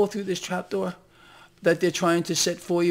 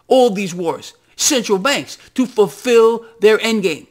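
rising into a loud, high-pitched tirade; heated rant; 7 semitones higher, 8 dB louder than usual. Real, not acted. Ranting to viewers about who funds the wars, loud and high. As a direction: hammer the questions out like an accusation, slowing on the key ones.

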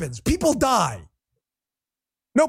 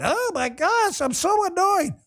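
mock whining; 5 semitones higher than usual. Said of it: "get his panties out of a wad".

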